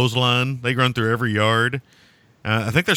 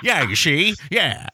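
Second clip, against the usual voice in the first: high, clipped-free tough-guy "yeah, see"; Edward G. Robinson gangster; 6 semitones higher, slower than usual. Pretend. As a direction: talk like an old movie gangster with a cigar in your teeth. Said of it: "got a cigar in your mouth".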